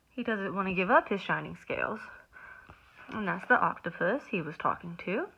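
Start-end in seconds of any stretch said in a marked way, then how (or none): none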